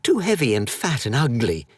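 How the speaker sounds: high, cheeky taunt; childish mocking boy. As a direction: tease the bear from safety. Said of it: "said Edwin, sticking out his tongue".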